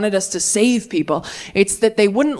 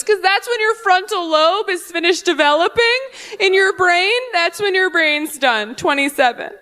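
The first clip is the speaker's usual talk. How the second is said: higher, smug lecturing voice; mock a lady explaining brains, pitched up; know-it-all heckler; 7 semitones higher than usual. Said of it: "She goes".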